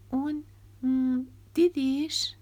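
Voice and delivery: halting worried question; anxious Nora. Acted. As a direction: probe gently, worried for her brother. Nora asks anxiously about brother.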